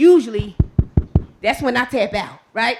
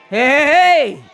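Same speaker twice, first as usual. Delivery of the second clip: triumphant cheer; 5 semitones higher, 9 dB louder than usual. Real, not acted.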